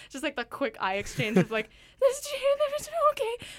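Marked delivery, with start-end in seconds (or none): 2.0-3.5 scared. Retold or imitated. Retelling hearing a ghost voice.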